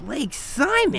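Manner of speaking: high, disbelieving Like Simon?; shocked question. Acted. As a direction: recoil at being compared to him. Daughter shocked at comparison.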